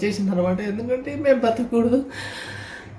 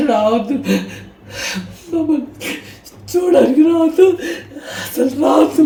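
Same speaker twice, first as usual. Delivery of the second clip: louder, higher tearful pleading; crying plea; 4 semitones higher, 3 dB louder than usual. Real, not acted. Telling relatives never to visit, crying louder. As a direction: beg through tears, rising.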